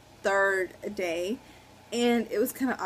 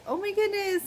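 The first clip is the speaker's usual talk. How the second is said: much higher, delighted exclamation; mom's excited gasp; 11 semitones higher than usual. Retold or imitated. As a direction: imitate mom thrilled at the ultrasound. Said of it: "she got so excited".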